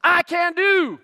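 high, loud rally call; pumped-up athlete's call-and-response; imitated gladiator athlete. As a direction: lead the crowd in a hammed-up chant. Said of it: "he talked like this".

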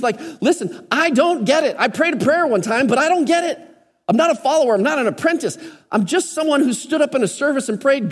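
pitched high and driving, full preacher fervor; impassioned altar-call preaching; preaching at full force. Acted. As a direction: bring it home to the whole room, high and urgent. Climax of the sermon.

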